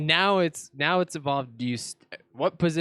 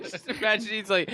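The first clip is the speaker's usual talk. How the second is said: laughing; 7 semitones higher than usual. Real, not acted. Laughing while describing.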